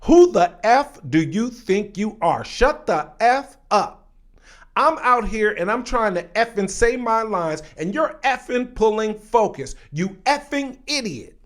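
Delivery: loud, high mock tirade, hitting each word; going off angrily. Actor berating actress for pulling focus, angry and loud. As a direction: re-enact a furious on-set telling-off at full voice. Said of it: "this man goes off on you".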